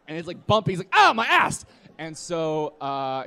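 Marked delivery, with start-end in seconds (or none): 0.9-1.6 high, loud comic yelp; hammed-up cry of pain; mock pain. Wounded friend jolted in the car, imitated loud yelp. Injured friend on bumpy ride.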